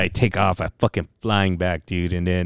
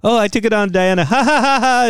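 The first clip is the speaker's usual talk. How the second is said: high, loud fake cackle; hammed-up ha-ha laughter; mock laughing boast; 13 semitones higher, 5 dB louder than usual. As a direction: announce it high, then force out big laughs. Acts out own mocking laughter at coworkers.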